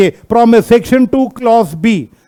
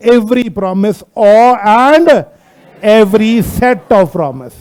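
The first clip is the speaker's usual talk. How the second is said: very slow, word-by-word reading; measured dictation pace; much slower than usual. Shown as a demonstration. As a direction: spell out the legal wording slowly for students. Reading the law's wording to class.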